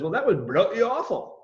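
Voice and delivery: put-on quick jokey British accent on bloody awful; mock British accent. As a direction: say it like a Brit, lightly. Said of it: "when a British person says".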